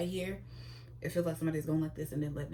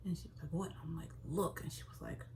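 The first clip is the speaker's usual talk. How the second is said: hushed retelling; 10 dB quieter than usual. Retold or imitated. Secretly telling Toya the news.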